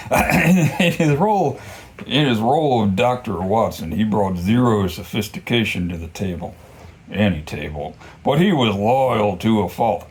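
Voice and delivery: reading the noir excerpt, unhurried; lightly voiced detective narration; story reading. Reading Ratso's description from the book, mild storybook narration.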